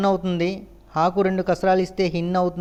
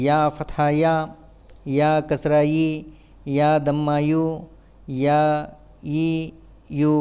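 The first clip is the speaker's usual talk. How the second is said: even, monotone syllable-by-syllable drill; measured classroom letter drill; spelling drill recitation; 3 semitones lower than usual. Shown as a demonstration. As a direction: recite it like a spelling chant, pausing between items.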